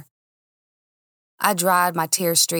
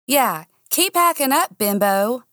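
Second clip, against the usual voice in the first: high, louder sneering jab at a rival; drunken taunt; 6 semitones higher, 3 dB louder than usual. A narrator's reading. Drunk woman taunting her rival at a party, high sneering jab. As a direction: toss the insult out loud, tipsy and spiteful. Drunk Steely insults the blonde.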